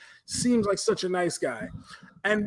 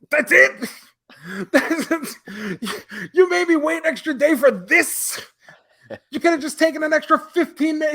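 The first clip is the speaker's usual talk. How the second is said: exasperated; 6 semitones higher than usual. Real, not acted. Ranting to his co-host about the letdown, exasperated. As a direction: vent your disbelief at the letdown.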